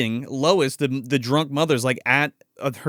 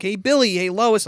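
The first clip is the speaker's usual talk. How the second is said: higher-pitched mock call through the house; 6 semitones higher than usual. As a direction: imitate a father looking for his family. Father calls out for family.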